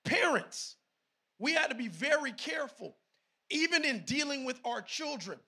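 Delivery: loud, emphatic preacher; forceful sermon delivery; preaching. Real, not acted.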